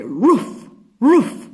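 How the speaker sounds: loud, booming woofs; big dog bark. Pretend. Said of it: "if it was a big dog".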